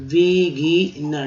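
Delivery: बिगिनर drawn out slowly, syllable by syllable; slow teaching pronunciation. Shown as a demonstration. Teacher modelling बिगिनर slowly for students. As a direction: stretch बिगिनर for beginners to copy. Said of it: "double N रहता है इसमें".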